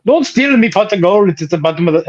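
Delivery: high, bright stage Irish accent. Shown as a demonstration. Gamer asked to say the line, in a high Irish accent. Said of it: "You did the accent".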